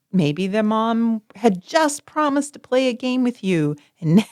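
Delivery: normal talking voice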